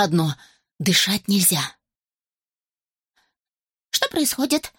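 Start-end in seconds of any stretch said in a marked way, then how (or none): 3.9-4.7 high anxious hen voice; worried hen. Acted. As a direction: fret like a nervous hen. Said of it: "заволновалась курица".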